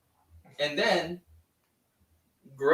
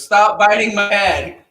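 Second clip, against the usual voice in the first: mock protest; 5 semitones higher, 11 dB louder than usual. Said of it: "And then Mando goes".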